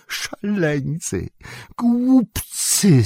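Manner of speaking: Gollum hissing, slow then shrieking. A narrator's reading. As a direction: spit the insults like a snake. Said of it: "syczał".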